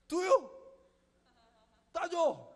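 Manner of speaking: put-on high, loud toddler tantrum. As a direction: wail it like a two-year-old. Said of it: "얘기 안 들어주면 난리 납니다".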